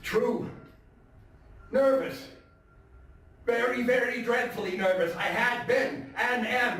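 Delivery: a nervous, wound-up killer confessing in a high, intense voice; tense madman narrator. Acted. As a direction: play the madman insisting he is sane, swinging between hushed and frantic.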